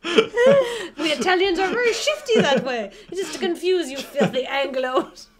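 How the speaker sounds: high, loud mock indignation through laughter; hammed-up ad character. Venice boat pitch to the Anglos, hammed-up. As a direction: declaim it, half losing it to giggles.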